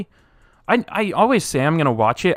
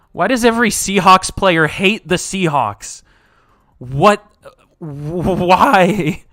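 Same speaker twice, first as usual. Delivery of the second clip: exasperated outburst; 5 semitones higher, 4 dB louder, slower than usual. Real, not acted. Frustrated fan ranting about Seahawks.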